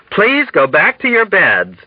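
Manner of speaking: high, insistent plea; pleading. A narrator's reading. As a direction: beg them earnestly to obey. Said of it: "Nurse Duckett pleaded".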